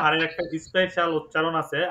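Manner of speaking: usual speaking voice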